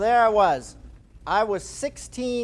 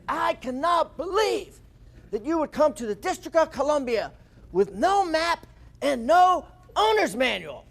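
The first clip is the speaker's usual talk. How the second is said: fuming outburst; 7 semitones higher than usual. Retold or imitated. Old mechanic fumes at lost teens, high exasperated rant. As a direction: act out a red-faced old man losing it. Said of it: "you just start seeing him fuming".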